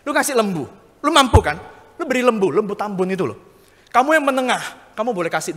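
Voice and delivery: brash, casual divine command; God giving orders. Acted. Preacher voicing God to the rich, a light commanding voice.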